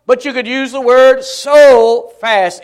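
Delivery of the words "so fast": SO long and emphatic before fast; stress on SO. Shown as a demonstration. Preacher shows emphatic word, stretching SO. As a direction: stretch out so, then land fast. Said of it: "It's an emphatic word".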